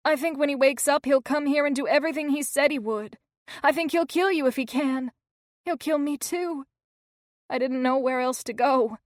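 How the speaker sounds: put-on a shaken girl reliving the attack; frightened young girl. A narrator's reading. Lizzie's voice in story.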